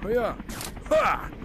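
loud thrilled whoop; excited shout. Real, not acted. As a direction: shout with glee. Big boost while swinging.

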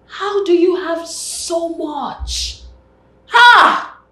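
high, loud, drawn-out amazement; loud, gaping awe; astonished. Acted. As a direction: exclaim slowly in high-pitched disbelief, ending on a shout. Exclaims over man's wealth.

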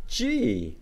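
usual speaking voice

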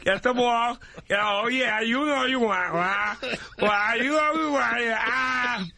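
a hammed-up Ray Romano in bed; Ray Romano impression. Comedian in studio, mock Ray Romano having sex. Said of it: "Ray Romano having sex".

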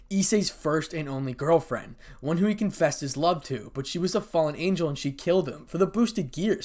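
plain reading voice

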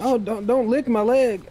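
nervous protest. Acted. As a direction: back away from a licking animal, pleading. Animal licking his leg.

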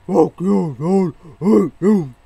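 shouted curse, high and loud; angry yell. Retold or imitated. Imitating someone yelling at a hated enemy, furious shout. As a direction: scream it at him in fury. Acted angry outburst quote.

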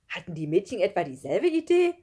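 loud, amused question; calling out. A narrator's reading. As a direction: call it out, tickled by the thought. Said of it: "rief Schlunz und lachte". (laut und belustigt gerufen)

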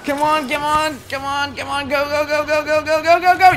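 building chant into a loud shout; excited cheering. Real, not acted. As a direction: chant go then roar in triumph. Racing to save objective.

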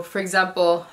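ordinary talk, nothing marked

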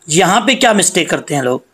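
ordinary talk, nothing marked

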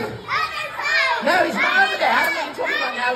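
squeaky, high no; high mock protest at the kids; high panto denial.

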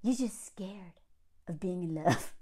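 whispered, mocking his line about fear of love; mock breathy whisper. Mocking the husband's proposal line in a mock whisper. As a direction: hush it like a cheesy seducer, taunting. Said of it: "He's like".

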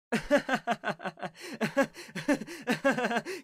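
long, bouncing run of laughter; amused laughter. Acted. Older brother laughing at the boy's big wish, amused. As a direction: laugh heartily at a naive wish.

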